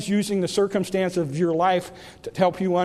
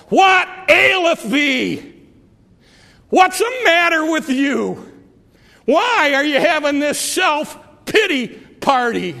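voice of God; 6 semitones higher, 4 dB louder than usual. Acted. Preacher voicing God rebuking self-pity, loud and high. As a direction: call down from heaven, firm and loud.

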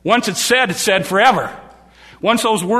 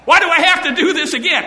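angry shout; 9 semitones higher, 4 dB louder than usual. Retold or imitated. Moses angrily scolding the people.

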